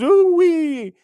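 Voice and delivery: imitating a viewer's high, loud "Ooh-wee"; mock excited hoot. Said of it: "John Doe goes".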